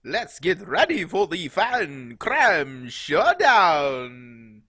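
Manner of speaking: slow, loud Transylvanian count voice; booming Transylvanian vampire accent. Pretend. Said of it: "you like my Transylvanian accent?".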